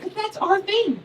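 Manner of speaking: put-on very high, assured kid voice; Tommy. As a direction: imitate a first-grader explaining earnestly. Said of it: "He's like".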